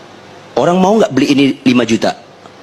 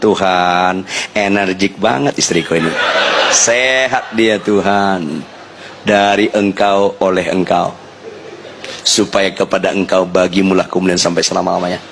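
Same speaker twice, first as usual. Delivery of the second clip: hushed prayer; 5 semitones lower than usual. Acted. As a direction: pray under the breath, calm and grateful. Quiet prayer to God.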